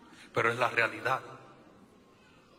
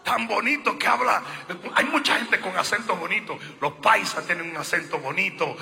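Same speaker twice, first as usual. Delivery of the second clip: ordinary talk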